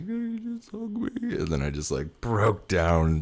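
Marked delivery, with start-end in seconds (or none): 0.0-1.4 high, breaking, pleading; a choked-up ask for a hug; to his girlfriend, imitated tearful plea. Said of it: "And I was like".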